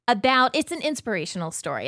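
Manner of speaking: normal talking voice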